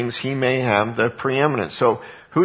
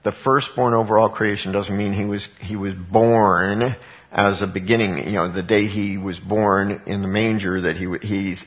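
ordinary delivery

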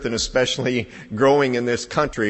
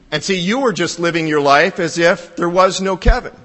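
usual speaking voice

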